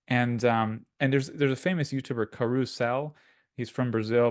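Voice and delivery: ordinary talk, nothing marked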